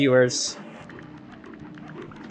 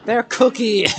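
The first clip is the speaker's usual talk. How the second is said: mock outrage; 10 semitones higher, 5 dB louder than usual. Joking about animal cracker bags.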